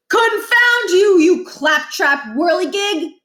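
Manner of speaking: high, loud, slow blustering rant; blustering principal. A narrator's reading. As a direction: curse the contraption like a fussy old man. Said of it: "Barkin said, apparently to the hang glider".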